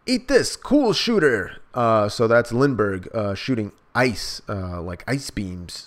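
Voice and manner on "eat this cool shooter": high, loud shout, cool shooter slow; battle shout. Acted. Lindbergh firing at the pirates, loud and high. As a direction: yell the attack name. Lindbergh attacking with ice gun.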